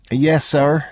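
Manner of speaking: a woman just woken, answering a customer; motel clerk woman. Acted. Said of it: "she said".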